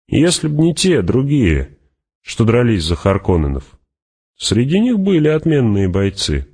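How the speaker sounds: high, lilting fighter's voice; Fremen warrior, matter-of-fact boast. Acted. Fremen reports the battle to Hawat, breezy and high. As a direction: recount it with lively, swinging pitch.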